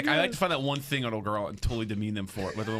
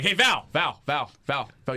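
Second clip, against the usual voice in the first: loud, higher call to get attention; retold insistent hail; 3 semitones higher, 4 dB louder, much slower than usual. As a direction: call a friend's name over and over. Calling across room to busy friend.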